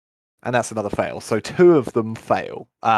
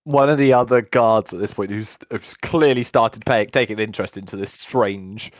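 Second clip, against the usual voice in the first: ordinary talk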